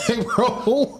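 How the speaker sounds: laughing slow, high; cracking up at his own story. Real, not acted. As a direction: let the laugh break through the words.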